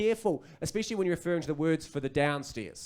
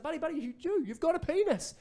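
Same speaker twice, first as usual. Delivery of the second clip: much higher, thrilled dad; excited encouragement; 12 semitones higher than usual. Acted. Dad encouraging his toddler son, high and excited. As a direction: cheer a small child on, high-pitched. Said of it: "I wanted to encourage it".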